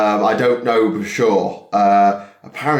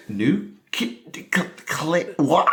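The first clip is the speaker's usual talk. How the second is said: confused student sounding out; 5 semitones higher, 5 dB quieter, slower than usual. Retold or imitated. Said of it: "some people are like".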